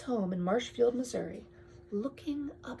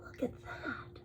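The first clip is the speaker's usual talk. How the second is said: soft hushed marvelling; softly amazed look at the stars; awed whisper; 16 dB quieter than usual. Real, not acted. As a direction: whisper it in wonder. Awed whisper over picture.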